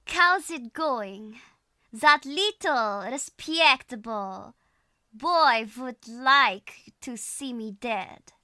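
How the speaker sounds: slow, heavy Russian accent. Shown as a demonstration. Streamer copying the tutorial's practice sentence, slow Russian accent. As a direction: draw each word out in a Russian accent. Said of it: "where we get the Russian accent sound".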